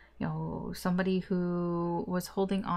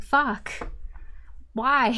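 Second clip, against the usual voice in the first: exasperated cry, higher; frustrated outburst; 5 semitones higher than usual. Retold or imitated. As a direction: throw up your hands and yell it. Voicing the querent's frustration.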